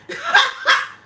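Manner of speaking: high, loud cackle; delighted high laughter. Real, not acted. Laughs at own impression.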